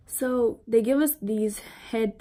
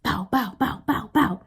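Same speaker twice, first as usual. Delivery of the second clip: gunshot noises; 2 semitones higher than usual. Retold or imitated. Imitating gunshots at the range.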